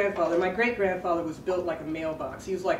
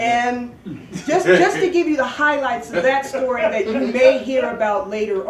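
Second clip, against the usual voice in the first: laughing; 4 semitones higher, 8 dB louder than usual. Real, not acted. Laughing while summarizing the story.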